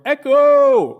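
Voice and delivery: high, loud call sent out across a valley; yelled call. Shown as a demonstration. Hiker on a mountain trail calling out to hear the echo, loud yell. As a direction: throw the call at the far ridge. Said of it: "compelled to yell out".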